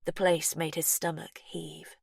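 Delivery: plain reading voice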